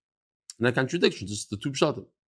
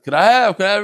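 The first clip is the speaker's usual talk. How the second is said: louder, higher mock pleading; grabby relatives asking; 4 semitones higher, 7 dB louder, faster than usual. Everybody wanting a piece of the inheritance, mock pleading. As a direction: play the eager relative begging for a share. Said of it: "Everybody comes, wants a piece".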